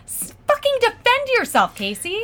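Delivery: high-pitched mock scolding; exasperated boyfriend. Boyfriend egging on his girlfriend, high exasperated scolding. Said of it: "And he says".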